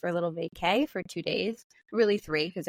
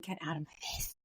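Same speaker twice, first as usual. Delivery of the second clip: much softer, lower aside; retold low grumble; muttered parental protest; 4 semitones lower, 11 dB quieter than usual. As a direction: mutter it under your breath like a fed-up parent. Worn-out parent's retold reply.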